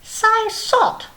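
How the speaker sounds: high, cheeky little snail voice counting; Snail puppet voice. Acted. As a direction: play a chirpy snail puppet showing off.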